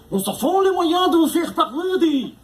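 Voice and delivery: high, drawn-out mock German interrogator; botched German accent. Torturer threatening a prisoner, clumsy mock German accent. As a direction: do a bad German accent, closer to Belgian. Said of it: "c'est plus accent belge. C'est l'accent allemand".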